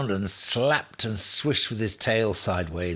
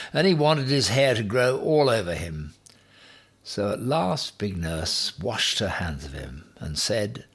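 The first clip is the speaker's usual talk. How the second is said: ordinary delivery, nothing marked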